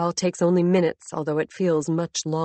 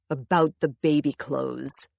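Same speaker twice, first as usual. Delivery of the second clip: low, venomous reveal; dripping with venom; 4 semitones lower than usual. A narrator's reading. Husband turning back at the door, venomous and low. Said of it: "His voice is dripping with venom".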